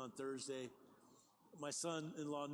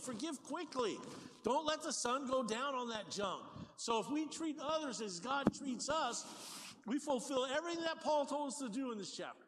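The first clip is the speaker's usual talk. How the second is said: higher, louder preaching drive; full-force preaching; 7 semitones higher, 3 dB louder than usual. Acted. Pastor urging the church toward forgiveness, preaching hard. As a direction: lift the congregation with rising fervor.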